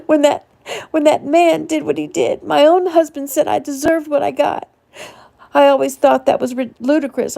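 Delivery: high, loud, breaking voice; anguished. A narrator's reading. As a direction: confess it through tears. Continues distraught confession.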